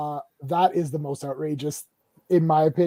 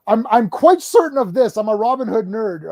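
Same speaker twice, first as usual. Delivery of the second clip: mock insistence; 5 semitones higher, 9 dB louder than usual. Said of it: "I'm like".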